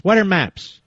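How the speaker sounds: usual speaking voice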